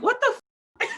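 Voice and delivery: very high mock what the; incredulous. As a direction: squeak it in disbelief. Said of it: "you're like".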